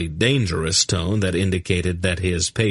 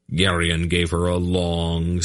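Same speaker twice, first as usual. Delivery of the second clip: usual speaking voice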